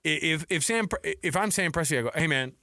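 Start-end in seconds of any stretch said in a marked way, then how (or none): none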